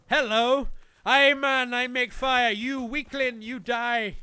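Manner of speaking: loud, declamatory primitive man; hammed-up loud taunt; mock caveman boast. Plays primitive fire-making man taunting enemy.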